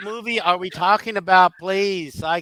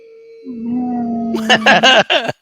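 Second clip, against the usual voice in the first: whale sound; 5 semitones higher, 7 dB louder than usual. Retold or imitated. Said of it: "They were saying".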